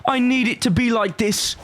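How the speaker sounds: put-on high, pleading little boy on the verge of tears; Victorian child. The inner little boy begging for the game, high and near tears. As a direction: whimper it like a fussy Victorian child. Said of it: "That was the little boy inside me".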